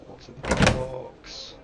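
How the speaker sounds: slow, breathy movie-quote whisper; dramatic whisper. Pretend. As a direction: whisper it like a thriller climax. Dramatic quote of famous line.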